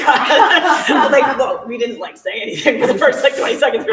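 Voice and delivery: giggling at the stunned silence; laughing. Real, not acted. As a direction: laugh through every word. Laughing while retelling the silence.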